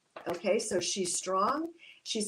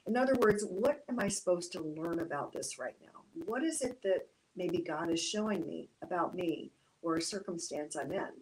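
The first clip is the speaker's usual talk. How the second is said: ordinary talk, nothing marked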